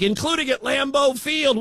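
ordinary delivery

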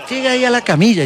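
voz ligeramente más aguda de doctora; doctora imitada. Retold or imitated. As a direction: imita a la doctora dando órdenes. (el invitado imita a la doctora, voz algo más aguda)

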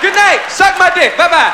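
loud, brash sign-off; mock stage shout. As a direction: bellow it at the crowd. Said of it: "walk out and say".

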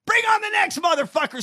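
fired-up fighter's shout, high and loud; Rocky. Acted. Host playing a movie boxer calling out opponents, shouting. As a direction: shout it as a challenge. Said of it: "says Rocky".

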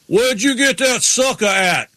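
high, slow, hammed-up country yell; Ward Burton impression. Ward Burton hollering at Kenny over a popsicle, a hammed-up country yell. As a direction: bellow it like a good old boy.

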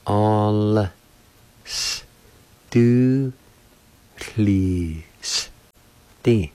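"All s do please D" in isolated sounds with long pauses; teacher separating every sound of "All s do please D"; syllable-by-syllable demo. Shown as a demonstration. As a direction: sound out "All s do please D" piece by piece, the s a lone hiss. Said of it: "like this".

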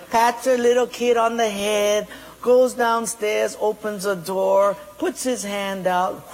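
ordinary talk, nothing marked

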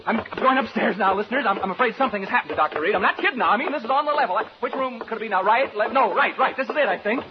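fast, high, loud panicked rush. Acted. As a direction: hurry upstairs in rising panic.

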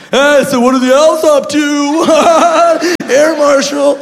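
loud, high, drawn-out fan gush; overexcited creepy fan. Acted. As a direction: blurt it like a starstruck creep. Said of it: "be a creep, like".

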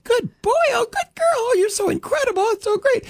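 put-on very high, squealing baby-talk praise; gushing praise. Doting owner praising the dog in a very high squeal. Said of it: "it sounds like".

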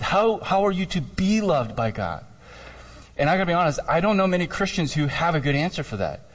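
usual speaking voice

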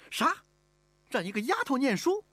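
high-pitched, outraged question; indignant disbelief. Acted. Mistress hears the maid may study, indignantly astonished. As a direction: balk at it as utterly absurd. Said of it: "乌雅氏瞪起了眼睛".

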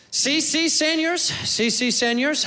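high, cocky gringo Spanish; bad Mexican Spanish. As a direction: overconfident tourist answering in bad Spanish. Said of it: "talking Mexican, like".

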